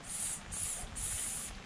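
soft hissing "S, s, s"; whispered hissing s. Shown as a demonstration. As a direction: hiss "s" softly like a snake. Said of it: "shall we do that together?".

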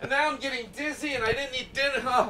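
laughing. Pretend. Same bit, winding down.

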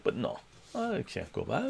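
ordinary talk, nothing marked

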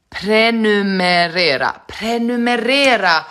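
"prenumerera" slowly as "pre-nu-me-RE-ra", R in both last syllables; corrected pronunciation. Shown as a demonstration. Streamer learning the word, slowly sounding out "prenumerera" with R, not N. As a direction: draw out "prenumerera" with the correct double R. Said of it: "vad säger jag?".

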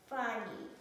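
normal talking voice

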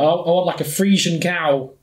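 normal talking voice

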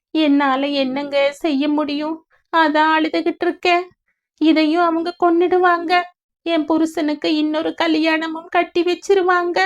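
high, monotone plaintive lament; sorrowful young mother. A narrator's reading. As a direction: pour out your grief, held high. Grieving mother's part in story. (உயர்ந்த குரலில் சோகப் புலம்பல்)

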